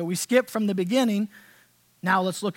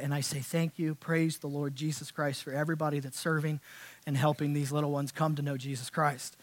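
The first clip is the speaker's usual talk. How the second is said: sincere thanks; 7 semitones lower, 10 dB quieter than usual. Real, not acted. Heartfelt thanks to ministry workers.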